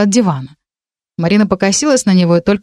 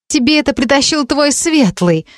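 higher-pitched suspicious question; процедила; 7 semitones higher than usual. A narrator's reading. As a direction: ask it pointedly, in a raised pitch. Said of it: "процедила Вика". (процедить с подозрением)

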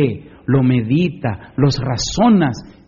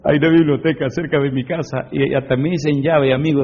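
ordinary talk

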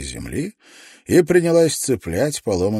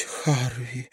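breathless whisper of his name; 5 semitones higher, 5 dB quieter than usual. A narrator's reading. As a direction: whisper it in shock. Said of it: "прошептала Каролина". (шёпотом)

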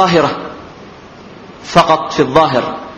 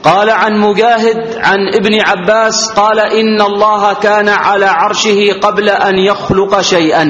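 higher, sustained reading tone; formal hadith recitation; 6 semitones higher than usual. Acted. Teacher reads a companion's report to students, formal recitation.

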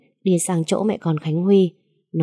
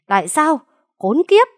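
Tại sao flung out high and loud, then Khốn kiếp screamed much higher; frantic yell; 11 semitones higher, 5 dB louder than usual. Acted. Father screams at the young man, beside himself. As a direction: lose all control and scream. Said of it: "như phát điên".

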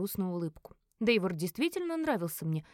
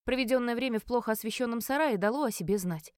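usual speaking voice

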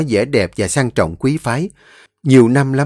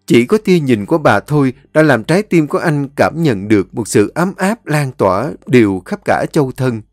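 ordinary delivery

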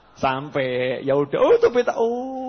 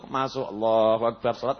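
ordinary talk, nothing marked

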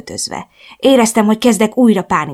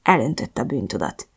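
usual speaking voice

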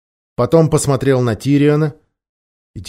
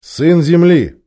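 slow, louder stern address; 5 semitones higher, 6 dB louder, much slower than usual. A narrator's reading. King addressing the rude dwarf, stern. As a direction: draw it out sternly, with authority. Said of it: "сурово сказал Тириан".

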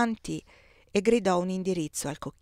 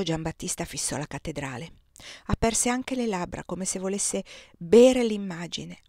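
plain reading voice

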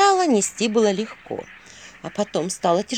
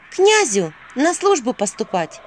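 slow, higher, a touch of a sigh; sighed reply; 6 semitones higher, 5 dB louder, slower than usual. Acted. Vasilisa explains her journey to the owl, sighing. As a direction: draw it out with a mild sigh. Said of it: "вздохнула Василиса".

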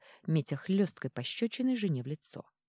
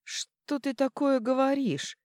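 higher, slow, genuinely puzzled; surprised; 10 semitones higher, 5 dB louder, slower than usual. Acted. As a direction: ask in honest disbelief. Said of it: "искренне удивился герцог".

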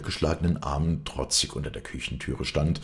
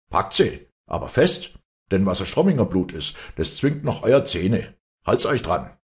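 loud, raised orders from a father; angry commanding; 5 semitones higher, 7 dB louder than usual. A narrator's reading. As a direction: goad the hesitating men into action. Father angrily orders crowd to seize her.

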